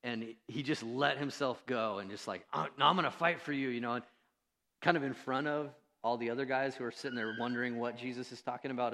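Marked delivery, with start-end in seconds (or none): 2.5-3.7 louder, higher, fired-up vow; mock Peter jumping in to defend; hammed-up loyal outburst; eager Peter.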